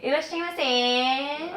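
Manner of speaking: Irashaimase with the last syllable drawn out long; stretched ending. Shown as a demonstration. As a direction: draw out the end of Irashaimase. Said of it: "extend the sound at the end".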